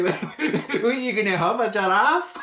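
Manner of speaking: laughing at the silly surprise; laughing mockery. Real, not acted. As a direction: tease through laughter. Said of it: "mocking laugh at the surprise".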